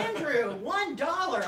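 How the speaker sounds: high, loud whoop with laughter; excited announcement. Real, not acted. Co-host thanking a viewer for a tiny donation, excited. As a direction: celebrate it as if it were a fortune.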